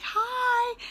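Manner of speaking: put-on high, cute child's hi; kid's greeting. Pupils greeting their teacher, high and cute. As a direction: chirp it like a small pupil. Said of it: "they're like".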